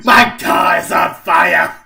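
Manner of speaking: shouting in panic; panicked shout. Acted. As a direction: yell it in alarm.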